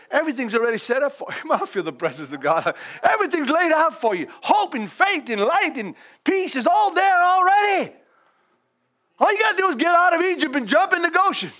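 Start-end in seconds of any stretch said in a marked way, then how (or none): 3.0-11.5 fervent revival shout; preaching at full force. Acted. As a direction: proclaim it to the congregation.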